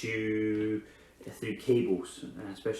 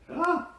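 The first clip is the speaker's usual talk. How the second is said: mock shriek; 4 dB louder than usual. Mock fright at scary animal.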